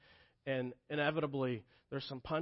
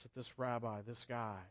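ordinary talk, nothing marked

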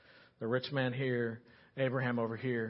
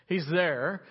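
normal talking voice

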